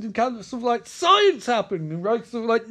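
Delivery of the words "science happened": high, loud, drawn-out proclamation; mock insistent shout. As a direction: stretch it out as a stubborn acted yell. Acted quarrel, Caleb insisting.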